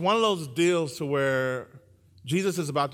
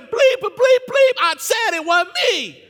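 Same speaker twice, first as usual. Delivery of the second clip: very high, furious comic yell; cussing out; 13 dB louder, slower than usual. Acted. Peter cussing out his accusers as he denies Jesus, yelling. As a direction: blow up with bleeped-out swearing. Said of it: "he cussed somebody out".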